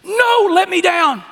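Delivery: put-on high, loud little boy protest; squirming kid. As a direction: wriggle free like a small child. Child squirming in a hug.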